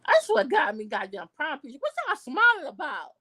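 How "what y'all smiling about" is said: high, scornful challenge; indignant. Real, not acted. Scolding the couple in the prom picture, indignant. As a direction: call them out with contempt.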